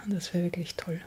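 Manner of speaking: longing whisper. Real, not acted. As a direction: breathe it out like a secret wish. Sehnsüchtiger Wunsch, geflüstert. (sehnsüchtig geflüstert)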